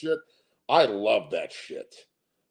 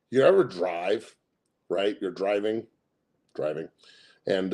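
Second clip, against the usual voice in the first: usual speaking voice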